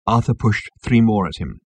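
usual speaking voice

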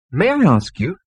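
sweet, polite persuasion; sweetest, reasonable. A narrator's reading. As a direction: ask in your sweetest, most reasonable voice. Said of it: "in its sweetest, most reasonable voice".

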